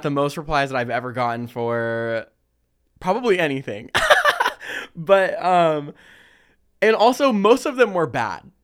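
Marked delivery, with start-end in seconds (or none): 4.0-4.9 laugh. Real, not acted. Laughs while reading replies.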